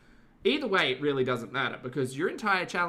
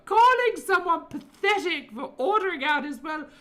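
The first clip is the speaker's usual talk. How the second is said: high, loud mocking impression; shrill parody of an angry streamer; 8 dB louder than usual. Mocking Foodie's angry reply.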